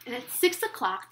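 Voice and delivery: usual speaking voice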